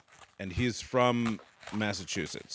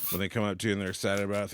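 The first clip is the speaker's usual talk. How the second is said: normal talking voice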